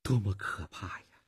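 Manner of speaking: soft, hushed exclamation of horror; low trembling murmur. A narrator's reading. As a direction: say it low, as if deeply moved. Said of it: "他低声地说". (低声感叹，带着震动)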